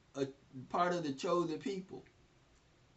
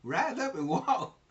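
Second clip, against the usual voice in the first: incredulous; 7 semitones higher than usual. Retold or imitated. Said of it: "He's like".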